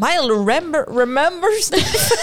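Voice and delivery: mock Australian accent; hammed-up Australian guy voice. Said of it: "je fais un accent australien".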